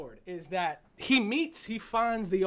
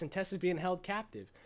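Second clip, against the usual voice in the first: ordinary talk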